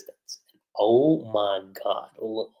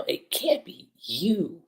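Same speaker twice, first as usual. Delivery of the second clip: slow, high-pitched disbelief; mock disbelief; 8 semitones higher, 4 dB quieter, much slower than usual. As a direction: draw it out high, imitating a stunned Lucy. Retold shock at seeing her double.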